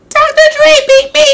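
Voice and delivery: very high, loud cartoon-mouse squeak; [name] speaking. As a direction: squeak it like Minnie Mouse or Betty Boop. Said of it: "she sounds like Minnie Mouse".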